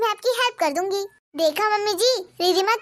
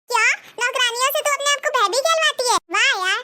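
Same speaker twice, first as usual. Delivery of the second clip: higher, teasing disbelief; mock shock; 5 semitones higher than usual. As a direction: gasp it out, half amused. Friend shocked, mocks Anjali. (सहेली की चिढ़ाती हैरानी)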